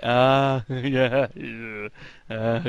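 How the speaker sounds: mock crowd murmur of approval; a hint of an audience mumble. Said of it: "a mumble of appreciation".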